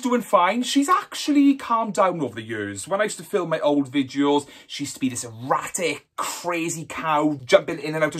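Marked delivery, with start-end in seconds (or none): none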